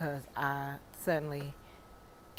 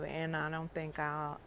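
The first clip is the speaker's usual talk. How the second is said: ordinary talk, nothing marked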